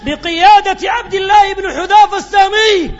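loud, high, ringing sermon voice; rising sermon climax; fiery preaching. Acted. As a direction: preach it out to the crowd. Preacher builds to full force.

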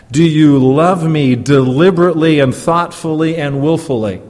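loud, pressing question; a preacher pressing the question at full volume; forceful question. Acted. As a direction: drive the question home hard.

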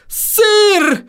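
high strained groan. A narrator's reading. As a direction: groan the word out in pain. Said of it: "простонал он".

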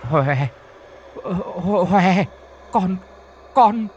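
begging her possessed son in terror; mother backs away from her son holding a knife; terrified pleading. A narrator's reading.

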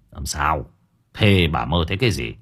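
a grumpy husband interrupting; irritated cutting in. A narrator's reading. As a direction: cut in, impatient. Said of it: "Ông Sửu khó chịu nói chen".

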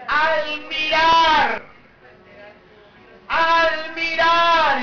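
"Al mirar" drawn out very slowly; emphatic key words. Real, not acted. Preacher giving congregation the key word, "Al mirar" slow and emphatic. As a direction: stretch each word of "Al mirar", held long and firm.

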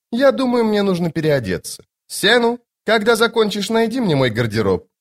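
high, imperious lady's voice; Lady Envy, airy. Acted. Lady Envy sending Senu for her wardrobe, high and airy. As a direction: order breezily in a high register.

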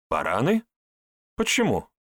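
questioning echo with a rising end; puzzled echo. Acted. Herbalist echoing Andrey's insult, puzzled. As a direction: throw the word back as a question.

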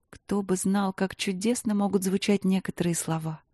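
plain reading voice

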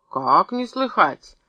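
slow, emphatic, worked-up retort; indignant exclamation. A narrator's reading. Опять подпрыгнул Юргин, indignant retort. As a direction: stretch it out with indignation. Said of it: "опять подпрыгнул Юргин".

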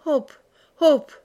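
choked, high and loud hop; strangled dying voice. A narrator's reading. Méloir urges the horse while drowning, strangled. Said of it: "d'une voix étranglée et mourante".

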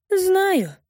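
high, falling hurt reply. Acted. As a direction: say it wounded and sulky. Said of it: "с обидой отвечает она".

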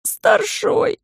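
a hint of a defeated wheeze, high; wheezed. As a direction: wheeze it out, deflated. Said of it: "сник и просипел".

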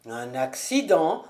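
low, menacing echo; wrathful. A narrator's reading. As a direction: repeat it in disbelieving anger. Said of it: "en lançant un regard courroucé".

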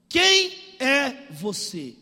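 loud, very slow, weighted question; questioning father. Retold or imitated. Blind Isaac asks who came in, slow and loud. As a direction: voice old Isaac demanding, word by word.

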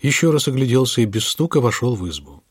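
usual speaking voice

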